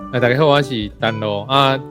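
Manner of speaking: usual speaking voice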